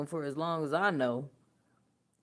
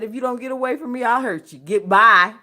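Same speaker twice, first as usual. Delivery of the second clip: mock threat to daughter; 3 semitones higher, 8 dB louder, faster than usual. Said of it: "I said".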